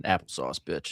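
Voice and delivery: low, dropped-voice taunt; smug one-liner. Retold or imitated. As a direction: mutter it low after the kill. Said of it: "you shoot someone in the back".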